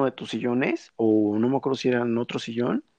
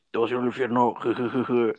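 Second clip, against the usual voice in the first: deep demonic voice; 2 semitones lower than usual. Retold or imitated. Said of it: "Pero así con voz súper, súper grave".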